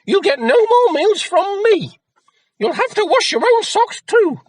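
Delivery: very high, loud shrieking scold; shouting mother. A narrator's reading. Mother yelling at lazy Jack, high piercing shout. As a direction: screech at your lazy son. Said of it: "she shouted".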